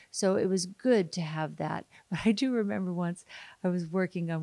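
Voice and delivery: usual speaking voice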